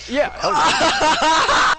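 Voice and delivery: high laughing cheer; excited shout. Real, not acted. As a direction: whoop it out, laughing. DM reacts to natural twenty.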